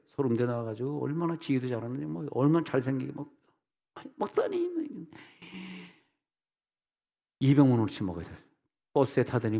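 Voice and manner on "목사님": very high, softer mock plea; squeaky, imploring call; pleading whine. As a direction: imitate someone wheedling the pastor. Imitates congregant imploring the pastor.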